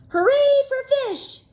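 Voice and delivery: high, excited seal cheer; high cartoon seal hooray. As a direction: squeal it like happy seals. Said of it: "said the seals".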